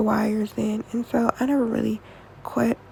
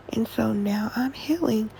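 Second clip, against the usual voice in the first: ordinary delivery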